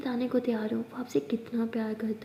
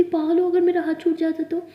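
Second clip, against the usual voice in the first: loud, high angry outburst; frightened scolding; 5 semitones higher, 9 dB louder than usual. Acted. Aliya scolds Maya after saving her, shouting in fright. Holding Maya's face, scolding.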